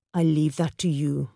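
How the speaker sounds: ordinary delivery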